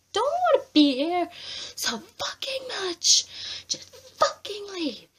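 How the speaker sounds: real angry rant at Perez; angry outburst. Real, not acted. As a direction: spit out the words at him. Ranting at Perez.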